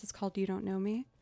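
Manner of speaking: usual speaking voice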